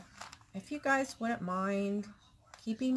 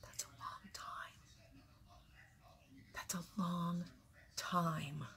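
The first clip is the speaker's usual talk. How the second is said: breathy whisper, barely audible; hushed, scared murmur; whispered dread; 2 semitones lower, 11 dB quieter than usual. Real, not acted. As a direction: let it slip out as a frightened whisper. Quiet dread about long recovery.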